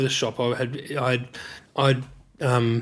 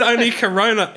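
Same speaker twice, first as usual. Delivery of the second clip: laughing; 9 semitones higher, 3 dB louder than usual. Real, not acted. Laughing while retelling.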